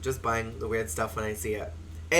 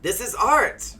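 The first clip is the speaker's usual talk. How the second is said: loud, high, melodramatic outburst; hammed-up exasperated shout; 9 semitones higher, 8 dB louder than usual. Trucker yelling at his oblivious brother, hammed-up exasperation. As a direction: play up the panic, rising to a high yell. Acted brothers bickering before the crash.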